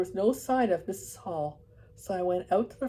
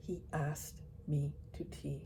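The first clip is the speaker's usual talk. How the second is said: slow, softer, each word spelled out; spelling out carefully; 4 semitones lower, 9 dB quieter than usual. Acted. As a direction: spell it out as to a fool. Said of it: "spelling the words out carefully".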